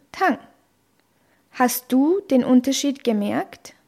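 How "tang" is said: Tang dropping sharply from high; fourth tone. Shown as a demonstration. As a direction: cut Tang down abruptly from the top. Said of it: "Noch einmal". (Tang im vierten Ton, abrupt fallend)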